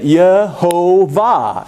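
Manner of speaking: Yehovah said slowly, Ye-ho-vah; Yehovah, slow English reading of the name; English rendering. Shown as a demonstration. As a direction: spell out Yehovah slowly, syllable by syllable. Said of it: "how in English would you say the name".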